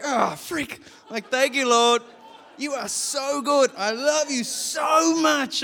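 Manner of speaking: high, loud, slow gushing thanks; overjoyed praise. Retold or imitated. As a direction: pour out your love to the Lord. Overwhelmed praise to the Lord.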